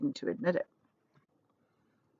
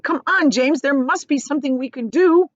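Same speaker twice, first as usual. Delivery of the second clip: high, louder pushing; urgent; 7 semitones higher, 5 dB louder than usual. Acted. Centipede urging James, high and urgent. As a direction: press him to act.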